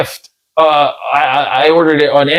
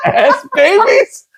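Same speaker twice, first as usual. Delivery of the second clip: high, drawn-out laughing question; helpless amused incredulity; laughing disbelief; slower than usual. Real, not acted. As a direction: squeal it through real laughter. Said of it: "I'm laughing now".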